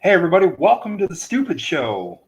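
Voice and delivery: loud, upbeat host intro; show intro announcer. Acted.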